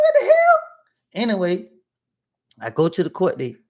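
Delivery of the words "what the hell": very high, loud squeal; mock disbelief. Suspect reacting to being locked up, squealed disbelief.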